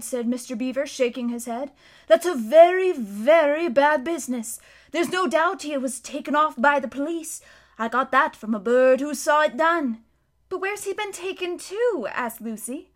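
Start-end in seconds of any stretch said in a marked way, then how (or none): 2.1-10.0 a beaver telling grave news, louder; lightly voiced old beaver, serious; gruff beaver voice. Mister Beaver tells Lucy about Tumnus, gruff and grave. 10.5-12.1 put-on high, worried little girl; lightly voiced young girl, fretting; anxious girl. Lucy asks Mister Beaver where Tumnus went, worried.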